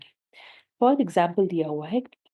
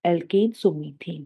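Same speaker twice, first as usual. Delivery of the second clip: slow deliberate naming; 2 semitones lower, much slower than usual. Shown as a demonstration. Teacher spelling out name.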